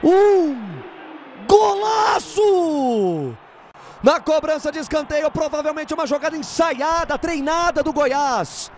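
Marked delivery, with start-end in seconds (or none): 0.0-3.4 slow, stretched goal cry; drawn-out goal shout. Real, not acted. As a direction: hold the golaço long.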